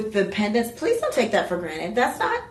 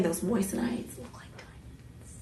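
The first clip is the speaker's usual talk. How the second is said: whispered aside; 2 semitones lower, 12 dB quieter than usual. Real, not acted. Confiding aside about the stones.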